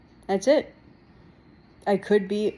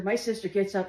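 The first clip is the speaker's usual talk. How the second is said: usual speaking voice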